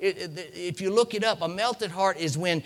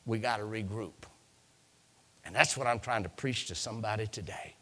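low, soft and earnest; hushed preaching; 6 semitones lower, 8 dB quieter, faster than usual. Acted. Preacher giving the Lord's word to somebody today, hushed and low. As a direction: bring it right down for one listener.

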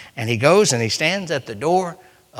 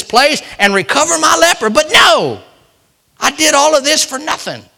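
loud, higher, indignant Naaman; indignant rant; 5 semitones higher, 8 dB louder than usual. Acted. Naaman, offended by the prophet's orders, rants loudly. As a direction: rant like a proud man insulted.

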